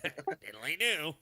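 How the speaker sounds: slow, stretched diddly do; Ned Flanders impression.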